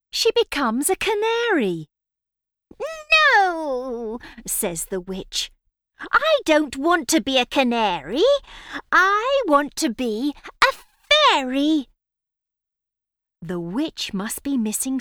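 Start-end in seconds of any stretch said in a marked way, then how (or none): 3.1-4.2 witch voice. Acted. Witch rejects the result. 6.0-11.9 whiny witch. Acted. Witch complains about the result.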